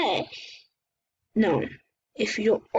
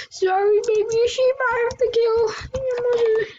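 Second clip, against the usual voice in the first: mock apology; 12 semitones higher than usual. Apologizing to the sheep.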